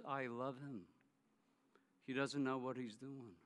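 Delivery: soft, low, gentle voice of God; tender answer. Retold or imitated. The Lord tells Dan he loves him, tender and soft. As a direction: say it softly with tenderness.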